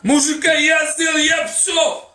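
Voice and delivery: high furious shouting; angry shout. Real, not acted. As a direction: shout him down, outraged. Heated quarrel, shouts heard.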